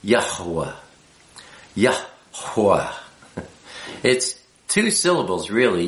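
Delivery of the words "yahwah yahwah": "Yahweh" with a breathy, emphasized H in the center; "Yahweh" as Yah-hwah, stressing the breathed H; emphasized middle H. Shown as a demonstration. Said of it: "with a little more emphasis".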